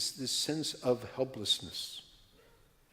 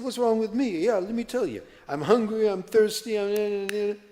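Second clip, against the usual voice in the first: exasperated complaint; 9 semitones higher, 8 dB louder than usual. Retold or imitated. Exhausted traveler lists his complaints.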